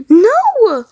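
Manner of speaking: high loud scream; desperate scream. Acted. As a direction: scream it in terror. Tom screams as Tord falls.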